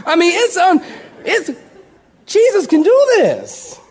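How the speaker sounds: high, loud, excited preaching; fiery preaching. Acted. Preacher to congregation, high and excited. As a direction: lift the whole room, half laughing.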